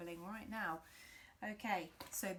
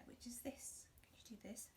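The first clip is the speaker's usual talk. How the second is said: whisper; 2 semitones higher, 12 dB quieter than usual. Real, not acted. Whispered aside to children.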